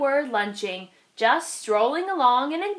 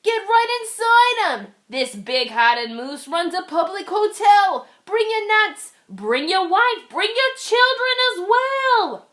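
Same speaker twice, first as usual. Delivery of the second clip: loud, high woodpecker call; a woodpecker welcoming everyone; 8 semitones higher, 5 dB louder than usual. Acted. Woodpecker invites all.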